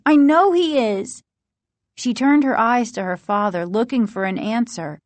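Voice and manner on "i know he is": high, louder assertion; emphatic raised-voice certainty; insistent. A narrator's reading. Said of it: "Margaret insisted".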